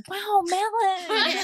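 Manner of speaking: mock American exclamation; imitated American accent. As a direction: accidentally echo an American accent, high and slow. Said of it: "about accents, my best friend in high school was American".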